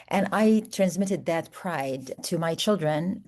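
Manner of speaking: ordinary delivery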